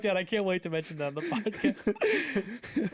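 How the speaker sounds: slow, high, laugh-filled quote; laughing retort. Retold or imitated. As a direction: retell it while cracking up. Laughing retelling of reply to dad.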